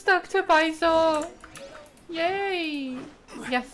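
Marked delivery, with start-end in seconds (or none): none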